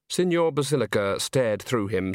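ordinary delivery

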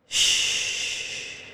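a quiet whispered shush; a soft whispered shh to calm the listener. Acted. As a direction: hush the noise gently, barely breathed. Hushing the self-doubt voices.

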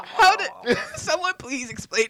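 high, loud plea through laughter; laughing exasperation. Real, not acted. Co-host asking how the talk got here, laughing exasperation. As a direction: demand an explanation, half laughing.

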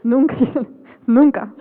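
laughing through the words; can't stop giggling while saying it. Real, not acted. Laughs at the joke.

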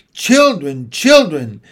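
loud call up the stairs. Acted. Mrs Banks calling the children on the stairs, loud. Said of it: "was calling".

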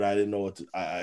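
ordinary talk

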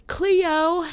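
high, drawn-out Aussie "Cleo"; Australian Cleo. Retold or imitated. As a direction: call "Cleo" like the show's Australian cast. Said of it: "as they used to say".

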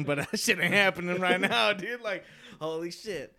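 ordinary talk, nothing marked

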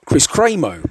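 "Cremo" said CRAY-mo, long first vowel; "Cremo" as CRAY-mo, stressed on the first syllable; corrected pronunciation. Shown as a demonstration. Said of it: "that's how you pronounce it".

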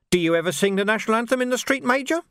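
menacing Carcer with a sly, amused edge; sneering sergeant. Acted. Carcer taunting the Major about the burned watch house, sly. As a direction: needle the officers with mock concern.

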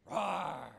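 short, loud mock roar; a loud playful lion roar. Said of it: "the enemy is like a roaring lion".

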